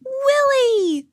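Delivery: high, long-held shout of the name; high-pitched astonished call; joyful cry. A narrator's reading. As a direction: cry it out high, stretching the end.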